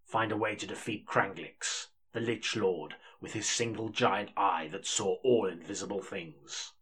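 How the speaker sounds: measured audiobook narration; story narration. Acted. Narrator opening the fantasy audiobook, measured storytelling. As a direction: read it like an epic audiobook.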